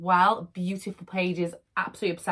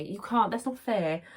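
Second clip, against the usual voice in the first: normal talking voice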